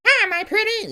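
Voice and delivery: high, loud wicked witch line. Shown as a demonstration. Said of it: "witch's cackle".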